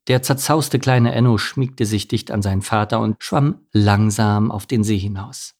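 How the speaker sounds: plain reading voice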